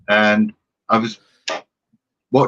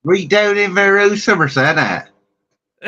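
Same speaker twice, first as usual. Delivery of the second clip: put-on Somerset accent, starting high and falling; hammed-up West Country Somerset voice; 9 semitones higher than usual. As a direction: play a Somerset local. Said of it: "I've got to do the accent".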